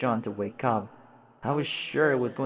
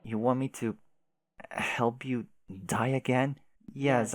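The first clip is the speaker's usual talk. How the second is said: normal talking voice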